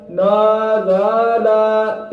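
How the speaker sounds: नाजाला with each vowel stretched one alif long; wrong lengthened reading. Shown as a demonstration. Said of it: "इस तरह गलत है।".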